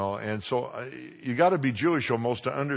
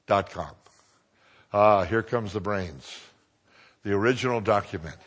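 usual speaking voice

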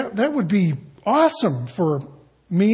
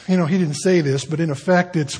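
normal talking voice

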